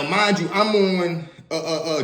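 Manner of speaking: normal talking voice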